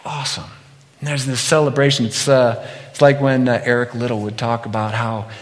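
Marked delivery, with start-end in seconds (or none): none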